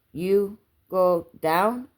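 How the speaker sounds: put-on small boy trying out English, word by word; hesitant child. A narrator's reading. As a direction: venture each word carefully, rising at the end. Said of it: "Kona had to be encouraged".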